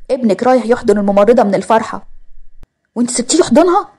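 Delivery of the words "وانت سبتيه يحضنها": shocked question. Acted. Mother-in-law reacts to hug story.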